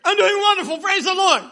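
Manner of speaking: loud, high, laughing fake cheer; fake cheerful lie. Broke preacher lying to the pastor, loud, high and laughing. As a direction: sell the lie with a big bright grin. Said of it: "I lied".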